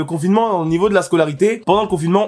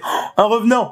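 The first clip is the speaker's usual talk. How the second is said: higher-pitched imitation of amazed classmates; mock surprise; 7 semitones higher than usual. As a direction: act as if seeing a ghost, teasing. Classmates greeting the rarely present student. (fausse surprise moqueuse, voix aiguë)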